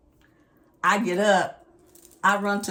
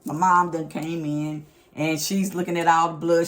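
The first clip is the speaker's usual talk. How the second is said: usual speaking voice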